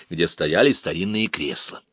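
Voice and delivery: ordinary delivery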